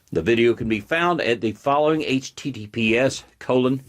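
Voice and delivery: usual speaking voice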